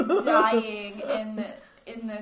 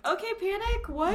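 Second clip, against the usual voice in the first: surprised; 9 semitones higher, 4 dB quieter than usual. Retold or imitated. Surprised at her own list.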